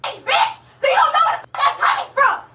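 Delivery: furious yelling; screaming insults at the cop; shouting. Real, not acted.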